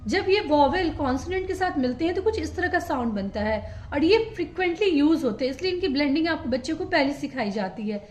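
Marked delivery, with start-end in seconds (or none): none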